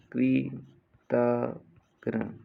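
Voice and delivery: very slow, gapped कृतघ्न; syllable by syllable. Shown as a demonstration. Teacher dictating to students, कृतघ्न slowly in syllables. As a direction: break कृतघ्न into separate sounds.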